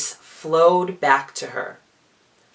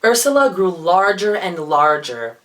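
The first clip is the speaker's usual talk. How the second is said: ordinary delivery, nothing marked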